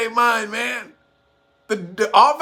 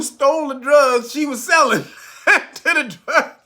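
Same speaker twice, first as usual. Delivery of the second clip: laughing; 5 semitones higher than usual. Real, not acted. Cracking up retelling the story.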